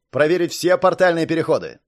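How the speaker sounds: loud, forceful command; urgent, authoritative bark; barked order. A narrator's reading. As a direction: raise the voice to order a subordinate. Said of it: "рявкнул Жук секретарю".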